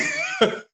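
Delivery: short, very high laugh; real laugh. Real, not acted. Finishing a funny story, real high laugh. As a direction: let a quick laugh escape.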